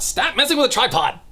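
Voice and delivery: high, fast burst of exasperation; exasperated scolding. Real, not acted. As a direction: scold a misbehaving cat, voice jumping up. Scolding the cat mid-recording.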